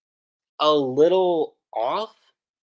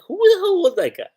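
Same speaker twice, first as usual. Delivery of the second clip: high-pitched, louder mock disbelief; baffled outburst; 5 dB louder than usual. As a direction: act out someone totally thrown by a stranger. Retold baffled reaction to a stranger.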